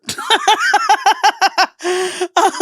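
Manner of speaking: loud giggling laugh; real high cackle of laughter; high burst of laughter. Real, not acted. Laughing at her own take.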